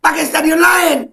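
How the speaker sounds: high, slow furious shout; ranting, shouting the demand; angry shout. Real, not acted. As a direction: shout it, fed up. Repeated shout.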